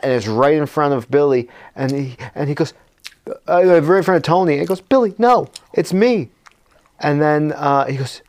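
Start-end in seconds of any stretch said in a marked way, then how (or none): 4.8-6.3 higher, urgent appeal; mock pleading. Tony facing his friend turned cat, mock pleading. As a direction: plead with a friend to recognise you.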